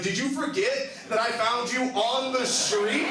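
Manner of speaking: high, loud, slow incredulous question; exasperated disbelief. Retold or imitated. Reminding drunk Lucas where he was found, slow incredulous. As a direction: spell it out in disbelief.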